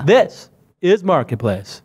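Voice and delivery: slow, drawn-out radio host voice; Adam Curry impression. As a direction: stretch out the announcement like a radio host. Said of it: "I just went into Adam Curry".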